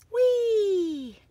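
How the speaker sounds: high, loud, delighted squeal of a word; a child's thrilled whee; gleeful whee. Acted. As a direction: let the seeds fly off with joy. Dandelion seeds fly off.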